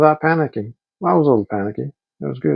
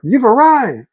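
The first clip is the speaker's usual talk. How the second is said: higher, chirpy robot car voice; car announcement; 10 semitones higher, 3 dB louder, slower than usual. Retold or imitated. The Waymo car telling riders they've arrived, chirpy and higher. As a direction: announce it like the cheerful car app.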